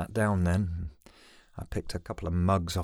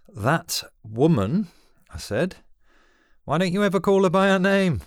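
angry retort; 12 semitones higher, 8 dB louder than usual. A narrator's reading. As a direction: bristle and confront him. Son angered by Dad's insult.

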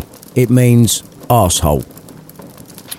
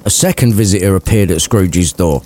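usual speaking voice

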